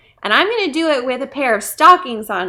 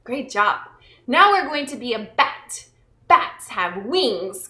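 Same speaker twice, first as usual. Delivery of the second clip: ordinary talk, nothing marked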